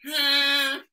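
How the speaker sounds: short replay of a horn blast; imitated horn. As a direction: honk like the game horn. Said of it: "he sound just like the horn".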